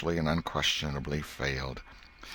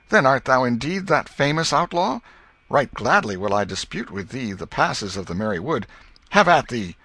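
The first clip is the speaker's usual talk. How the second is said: boyish mock knight; 6 semitones higher, 6 dB louder than usual. Joe declaiming challenge.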